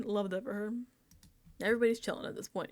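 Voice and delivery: ordinary talk, nothing marked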